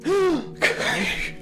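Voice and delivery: shocked gasp. Acted. Lawyer hears of murder.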